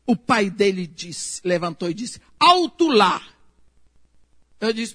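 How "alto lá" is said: loud, higher "Alto lá!" halting her; the father cuts her off; mock stern shout. The father standing up to stop the accusation, a loud halt.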